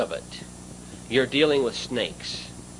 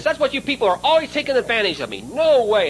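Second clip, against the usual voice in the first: carrying on fierce; 10 semitones higher, 3 dB louder than usual. Retold or imitated. Salesman accusing buyers of taking advantage, with mock fierce upset. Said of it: "started carrying on something fierce".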